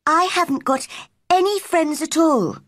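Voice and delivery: put-on high girlish voice, a touch forlorn; Mary speaking small and sad; lonely girl. A narrator's reading. Mary tells the old gardener, in a high, wistful girl's voice.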